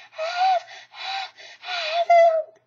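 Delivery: high, loud, slow panting stammer; breathless stammer. A narrator's reading. Piglet gasping out the news to Christopher Robin, breathless. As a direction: stammer between gasps for air. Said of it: "breathing so hard he could hardly speak".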